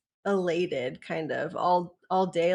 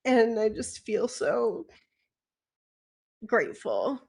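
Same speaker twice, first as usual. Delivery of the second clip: getting emotional; 3 semitones higher than usual. Real, not acted. Thanking Christine and the community, getting emotional. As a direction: voice catching with real emotion. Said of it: "I'm gonna get emotional".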